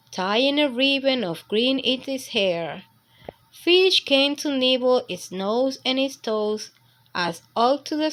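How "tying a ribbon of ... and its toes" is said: ordinary delivery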